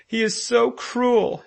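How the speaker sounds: put-on high, slow, loud child's wail; sobbing. A narrator's reading. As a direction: sob out the complaint. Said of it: "he sobbed".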